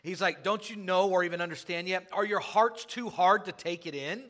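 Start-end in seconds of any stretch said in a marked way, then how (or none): 0.4-2.1 higher, louder exasperated rebuke; frustrated Jesus. Acted. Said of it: "He's getting a little frustrated". 2.1-4.2 higher, louder exasperated rebuke; frustrated Jesus. Acted. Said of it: "He's getting a little frustrated".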